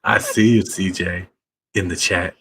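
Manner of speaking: hammed-up greeting. Greeting CJ in the chat, hammed-up and low. Continues the bit for CJ.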